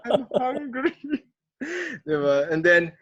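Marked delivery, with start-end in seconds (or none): 0.1-1.0 put-on high whiny childlike whimper. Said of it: "So we're like".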